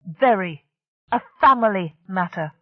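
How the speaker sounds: Very. A family matter, with family hit hard; stress on family. A narrator's reading. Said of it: "She stressed the word family".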